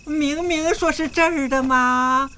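high, slow, drawn-out whine; whiny protest. Acted. Locked-out groom insisting it is this room, whiny. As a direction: stretch the complaint out plaintively.

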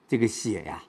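ordinary delivery

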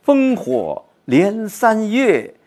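缓慢朗诵; poetry recitation. Shown as a demonstration. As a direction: stretch each word, yearning. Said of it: "他这一种期盼".

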